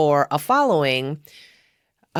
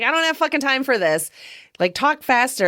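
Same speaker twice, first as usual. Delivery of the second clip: higher, quick impatient quote; imitated impatience; 8 semitones higher than usual. Sean quoted hurrying the old lady's explanation, mock impatient. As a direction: mimic someone with no time to listen.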